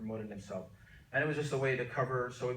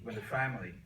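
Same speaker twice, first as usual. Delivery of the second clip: usual speaking voice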